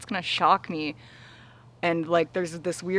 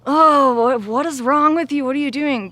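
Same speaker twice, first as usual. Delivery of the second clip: higher, louder exasperated scolding; annoyed; 8 semitones higher, 8 dB louder than usual. Retold or imitated. Annoyed at her aching heart, scolding it in exasperation. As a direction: snap at your own body like it's misbehaving. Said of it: "my first response is usually, like, annoyed".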